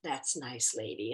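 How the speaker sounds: a cool, low "sure, lady"; dismissive mother. Retold or imitated. The dog owner's mother brushing her off, low and dry. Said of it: "her mother kinda looked at me like".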